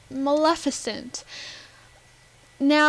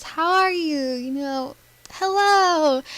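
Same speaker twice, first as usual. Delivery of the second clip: sweet friendly greeting; 3 semitones higher, slower than usual. Retold or imitated. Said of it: "she was all nice".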